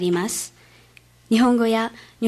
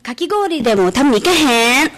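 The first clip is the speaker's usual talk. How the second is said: louder, lively Osaka-dialect invitation; Osaka dialect invite; 3 semitones higher, 8 dB louder than usual. Retold or imitated. Host family inviting the student for shaved ice, in louder Osaka dialect. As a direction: call them along in easy Osaka speech. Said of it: "自分たちが大阪弁を話している".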